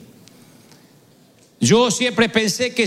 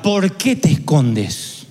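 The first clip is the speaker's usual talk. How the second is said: slow question; 6 semitones lower, much slower than usual. Shown as a demonstration. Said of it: "no pregunta".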